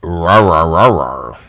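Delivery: low growling rah rah; deep monster voice. Retold or imitated. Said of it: "You sound like".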